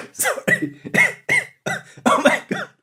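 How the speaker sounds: real wheezy, strained voice between coughs. Real, not acted. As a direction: wheeze it out after a coughing fit. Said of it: "I sound like Wheezy from Toy Story two".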